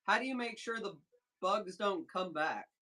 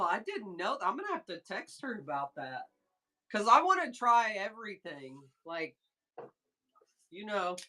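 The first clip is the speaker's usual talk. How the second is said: ordinary talk, nothing marked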